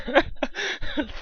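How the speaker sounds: high squeaky laugh; high burst of laughter. Real, not acted. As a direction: break into giggles. Laughing after announcement.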